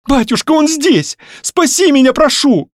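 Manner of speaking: high-pitched frantic cry for help; panicked plea. Acted. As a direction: daughter begging her father to save her. Frightened daughter pleads with father. (испуганная мольба высоким голосом)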